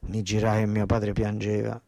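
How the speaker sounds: normal talking voice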